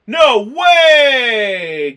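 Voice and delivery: loud, high "No way!" in exaggerated shock; mock shocked shout. Retelling Gail's reaction to co-host, hammed-up shocked shout. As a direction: shout it like a gasping character. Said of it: "Gail says".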